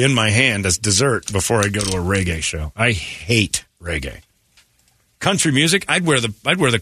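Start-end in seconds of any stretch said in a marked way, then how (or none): 2.8-4.2 slowly, every word weighted; slow emphatic disgust. Real, not acted. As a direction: drag out the hate.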